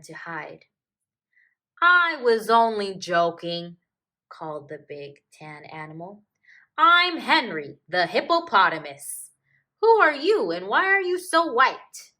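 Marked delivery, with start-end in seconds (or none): 1.8-3.8 big, loud friendly hippo voice; jolly hippo. Acted. Hippo calls to the hiding cub, loud friendly reassurance. 6.8-12.1 big, loud, higher hippo voice full of curiosity; jolly hippo. Acted. Hippo introduces himself to the lost cub, loud and curious.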